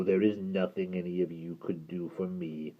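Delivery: low gravelly creature voice; hideous creature speaking low; deep creature voice. Acted. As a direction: rumble it like a hideous lake creature. Creature refuses.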